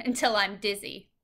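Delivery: ordinary delivery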